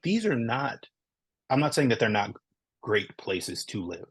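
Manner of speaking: normal talking voice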